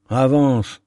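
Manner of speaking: ermite qui presse le pilote, ferme; pousse-le à avancer, vif et pressant; vivement. A narrator's reading. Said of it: "dit vivement l'ermite". (l'ermite presse le pilote : « Avance » dit avec vivacité)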